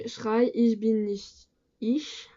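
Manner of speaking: German title in a French learner's accent; non-native German accent. Real, not acted. Vlogger apologizing for her German names a song, French-accented German. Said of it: "désolée pour mon accent allemand".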